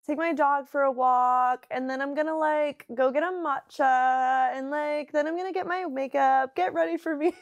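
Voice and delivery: sing-song influencer vlog. Pretend. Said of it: "what influencer voice sounds like".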